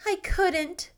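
mild pathetic plea. A narrator's reading. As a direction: sound helpless and sorry for yourself. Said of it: "said poor Janet pathetically".

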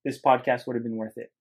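ordinary delivery

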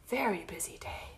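breath-only bedtime whisper; hushed whisper. A narrator's reading. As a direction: hush it for a sleeping spider. Spider falls asleep at story's end.

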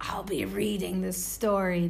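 whispered witch voice; hushed, secretive witch whisper. Acted. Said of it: "Talking like a witch".

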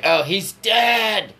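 hammed-up high, dragged-out exclamation; mock tweaker woman. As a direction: drawl out her odd announcement in a put-on voice. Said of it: "she's like".

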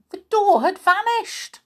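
ordinary delivery, nothing marked

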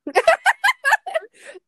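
high loud cackle; shrill burst of laughter. Real, not acted. Reacting to baby talk topic.

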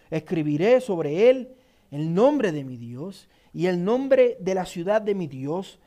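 ordinary talk, nothing marked

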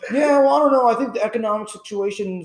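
mocking a know-it-all talking stock markets; mock expert. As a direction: ham up a know-it-all economist. Mocking student playing stock expert.

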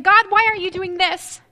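mock grievance aimed at God; complaining to God. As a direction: push God with an impatient complaint. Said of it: "live in that place of saying".

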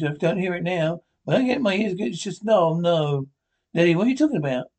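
ordinary talk, nothing marked

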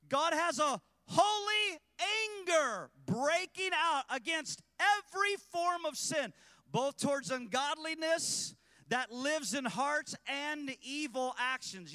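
loud, high, slow, hammering each word; preaching at full force. Acted. Preacher warning the congregation, loud and high. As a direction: thunder it from the pulpit.

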